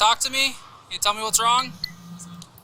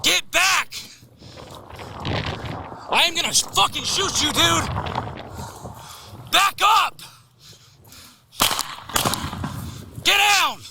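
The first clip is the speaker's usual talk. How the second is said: frantic shouting; 7 semitones higher, slower than usual. Real, not acted. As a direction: scream the orders at him. Cop shouting during struggle.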